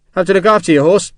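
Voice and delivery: casual soldier's chatter to keep her from crying; chatty soldier Sharp. Acted. Soldier chatting to a shaken woman about horses, light chatty voice.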